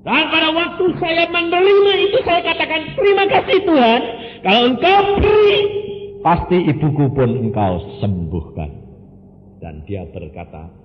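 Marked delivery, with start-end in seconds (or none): 0.1-8.7 loud, high, fast sermon cry; fiery preaching. Acted. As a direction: preach it out at full force. Preacher retells prayer loudly. (khotbah berapi-api dengan suara keras)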